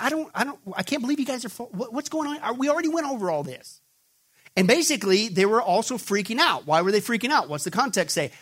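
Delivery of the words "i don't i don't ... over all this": higher, hushed mock disbelief; Paul blown away. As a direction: lightly act out Paul's baffled exasperation. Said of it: "He's like".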